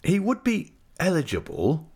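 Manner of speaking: "eligible" set apart with strong emphasis; stress on ELIGIBLE. A narrator's reading. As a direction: lean hard on the word "eligible". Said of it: "with a strong emphasis on that word".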